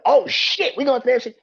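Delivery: high, loud mock cheer; imitating a player's thrilled outburst; excited shout. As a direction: players celebrating the AFC berth, loud and happy. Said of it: "sit back and be like".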